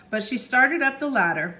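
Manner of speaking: plain reading voice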